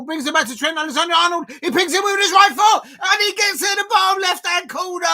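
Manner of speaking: rising to a full high yell as he scores; excited commentator's roar at the finish; goal call shout. Real, not acted. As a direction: scream the goal home. Live goal call as Trent scores.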